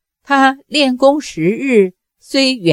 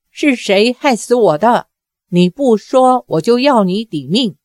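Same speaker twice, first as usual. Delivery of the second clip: ordinary delivery, nothing marked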